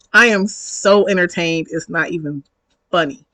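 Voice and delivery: usual speaking voice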